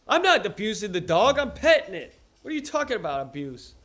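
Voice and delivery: loud, high-pitched protest; yelling. Real, not acted. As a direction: yell in indignant self-defense. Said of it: "I'm yelling because I can't hear".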